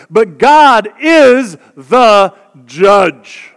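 very slow, weighty scripture reading; slow emphatic reading. Real, not acted. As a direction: lean into each word, slowly.

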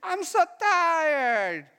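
long drawn-out, high-pitched whine; whining child. Acted. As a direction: drag it out like a worn-out kid. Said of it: "Who has kids?".

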